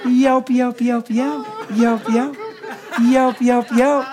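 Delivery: a lightly voiced hen yelp, repeated; playful hen yelps for the hunting story; hen turkey call. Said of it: "I sounded like a sweet old hen".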